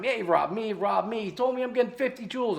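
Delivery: high-pitched mocking customer voice; mock duped customers. People messaging him all the time, mocking high-pitched imitation. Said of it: "I get messages from people".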